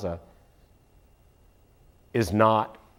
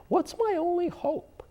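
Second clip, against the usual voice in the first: my pitched much higher, slow and soft; high drawn-out question; 12 semitones higher, 8 dB quieter than usual. Shown as a demonstration. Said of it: "repeated quiz question to Carol".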